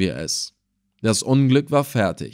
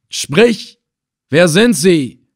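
loud, high, insistent order; sharp demand; 6 semitones higher, 7 dB louder, much slower than usual. A narrator's reading. Slave trader pressing the silent old man, demanding. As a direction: demand an answer forcefully. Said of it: "wiederholte der Sklavenhändler".

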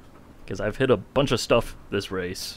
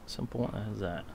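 softer, trailing off; quiet; 12 dB quieter than usual. Shown as a demonstration. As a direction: let it fall away. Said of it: "said twice, second softer".

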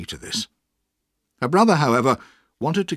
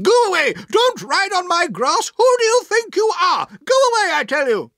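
furious high-pitched shout; outraged aunt yelling at trespassers; angry shouting; 9 dB louder than usual. A narrator's reading. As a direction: shout them off your lawn, very high and loud. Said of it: "waving her umbrella angrily at them and shouting".

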